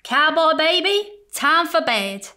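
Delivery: put-on a kind sheriff calling the child to bed; Sheriff Parr. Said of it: "and Sheriff Parr said".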